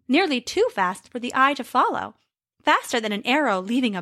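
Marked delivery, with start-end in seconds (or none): none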